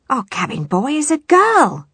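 high, loud, rising disbelief; astonished. A narrator's reading. Pirate learns the cabin boy's secret, astonished. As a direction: exclaim it, soaring on the last word.

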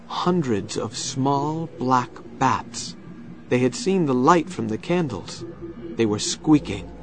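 plain reading voice

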